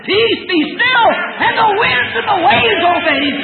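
fervent pulpit proclamation; preaching at full force. Acted. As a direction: lift the scripture high like a revival sermon. Preaching climax.

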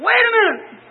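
high, sudden cry of surprise; "Wait a minute!" burst out, startled; startled exclamation. Retold or imitated. Startled by the vision.

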